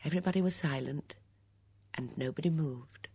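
soft, tense narration; hushed suspense. A narrator's reading.

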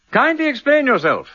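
mock indignation. [name] teasing Harriet, mock-stern and high. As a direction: play the outraged guardian, tongue in cheek.

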